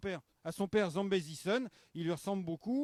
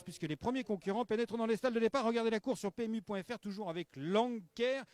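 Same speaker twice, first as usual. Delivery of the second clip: upbeat race announcer push; 4 semitones higher, 4 dB louder than usual. Acted. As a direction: sell the race to viewers. (voix d'annonceur de course)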